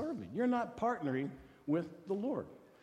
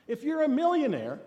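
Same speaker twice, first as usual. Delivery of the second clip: emphatic; 8 semitones higher, 8 dB louder than usual. Real, not acted. Building point on never enough.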